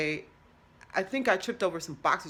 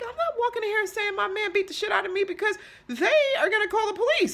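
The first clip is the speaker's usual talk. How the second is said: much higher voice, growing louder; high-pitched protest; 13 semitones higher, 4 dB louder, faster than usual. Acted. Patient to doctor about police, high-pitched protest. Mock reply to doctor's advice.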